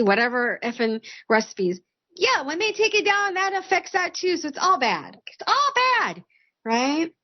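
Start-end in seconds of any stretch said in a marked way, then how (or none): 5.3-6.2 much higher, sing-song repeat of "all bad"; emphatic repeat. Real, not acted. Streamer repeating to chat it is all bad, pitched way up. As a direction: throw it up high for emphasis.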